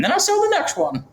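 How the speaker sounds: put-on very high, thin old-man voice; squeaky elderly man's voice; old roofer voice. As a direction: play the eighty-four-year-old roofer, cracking high. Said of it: "I'm gonna do the voice".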